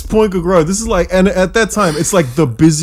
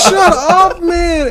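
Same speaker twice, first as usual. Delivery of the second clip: giddy banter; 13 semitones higher, much slower than usual. Retold or imitated. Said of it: "he's like".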